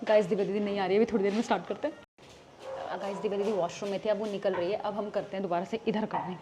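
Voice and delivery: low, soft aside to the camera; hushed secret update. Real, not acted. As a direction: keep your voice down so nobody overhears. Secret setup, sister might hear.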